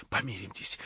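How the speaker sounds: soft, low hushed plea; convulsive whisper. A narrator's reading. Verkhovensky pleading with Stavrogin in a hushed whisper. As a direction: breathe the plea out in a tense hush. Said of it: "прошептал он ему судорожным шёпотом".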